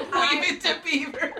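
laughing through the words; high giggling delivery. Real, not acted. Laughing at the shop name.

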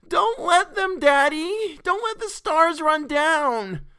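very high, loud wail; shrieking kid, last words drawn out; child's howl. A narrator's reading. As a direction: howl it like a panicking little girl. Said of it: "set up a howl".

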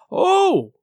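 high, drawn-out 'oh'; loud exclamation. A narrator's reading. Silver greeting the new cabin boy, loud exclamation. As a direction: let the cry leap up high in pitch. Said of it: "quite loud".

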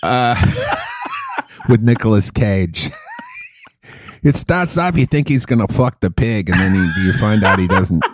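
put-on Boston accent, explaining the film; Boston movie critic. Comic defending his Pig review, Boston movie critic accent. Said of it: "you called it The Pig".

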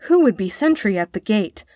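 ordinary delivery

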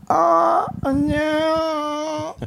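high, drawn-out mock moan "Oh, no"; stroke victim groan. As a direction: moan it like a wrecked patient in a wheelchair.

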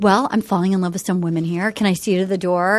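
low, quick mock-bachelor voice; imitated bachelor. Bachelor shows a latecomer the door, imitated low and quick. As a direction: play him low and brisk, level in volume. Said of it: "he was like".

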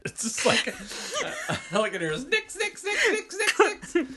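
high squealing laughter through words; laughing. Real, not acted. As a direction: giggle the words out. Laughing at repeated word.

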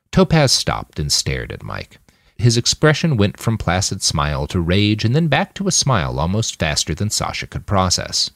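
ordinary delivery, nothing marked

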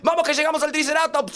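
very high, excited rallying cry; rally the kid toward the next level; frantic high-pitched dad; urging on. Acted. Acted dad urging son on.

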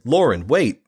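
high, loud plea to stop; urgent call. Acted. Julian pleads urgently.

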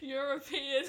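giggling through the word; can barely say it laughing. Real, not acted.

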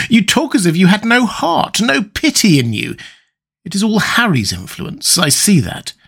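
high-pitched, appalled reproach; distressed older friend. A narrator's reading. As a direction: plead with a friend you no longer recognise. Hallward reproaches the changed Dorian.

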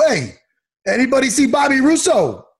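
high, hammed-up Brooklyn Italian accent; calling out in mock Italian-American accent; bad Italian-guy impression. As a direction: imitate a movie tough's fake Italian accent. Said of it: "Steven Seagal does the worst Italian guy".

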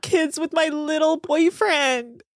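hammed-up weepy pleading; imitated crying. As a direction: whine it like a tearful lament. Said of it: "but she was crying".

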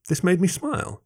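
ordinary delivery, nothing marked

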